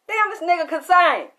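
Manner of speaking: imitating a fan's loud, high amazement; amazed outburst.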